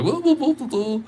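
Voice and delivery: high, silly voice parodying himself; squeaky mock-announcer self-parody; mock self-important voice. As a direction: ham up a pompous streamer blathering. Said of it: "I'm just over here just like".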